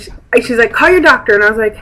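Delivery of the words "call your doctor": high, loud imitated Russian accent. Pharmacist telling patient what to do, mimicked Russian accent. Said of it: "her Russian accent".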